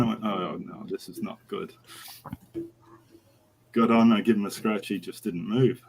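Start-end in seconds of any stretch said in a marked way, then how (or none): 0.2-1.7 much softer, sinking aside; muted dread. Retold or imitated. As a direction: dread it under your breath. Rider's dread on being told to mount.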